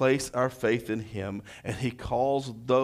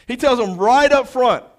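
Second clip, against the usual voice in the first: much higher and louder, preacher raising his voice; preached loud; 12 semitones higher, 13 dB louder than usual. Shown as a demonstration. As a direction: lift it into a full preaching call.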